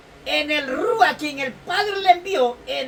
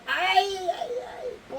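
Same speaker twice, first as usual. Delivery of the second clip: anguished cry; 5 semitones higher, 6 dB quieter, much slower than usual. Real, not acted. Preacher crying out in anguish.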